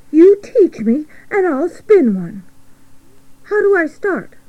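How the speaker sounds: high, excited little pig voice; childlike, eager squeak; eager piglet. Acted. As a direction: pipe up keen to learn. Eager piglet asks for lesson.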